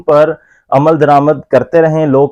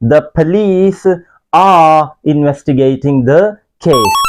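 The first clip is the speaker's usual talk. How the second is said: slow model sentence, police lifted; correct example; much slower than usual. Shown as a demonstration. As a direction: read the fixed sentence slowly. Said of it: "इसको सही करते हैं". (सही वाक्य धीरे-धीरे)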